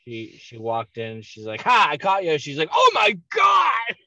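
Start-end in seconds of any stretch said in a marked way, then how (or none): 1.6-2.4 loud, high retold triumphant cry; mock "aha!" pounce; mom's gotcha. Mother bursts in, catches him. 2.7-3.9 high, slow, shouted mock horror; drawn-out retold shriek of shock; mom's shocked scream. Mother's shock on walking in.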